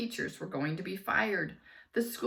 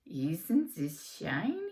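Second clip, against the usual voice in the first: coaxing; 2 semitones lower, slower than usual. A narrator's reading. Hypnotist coaxing.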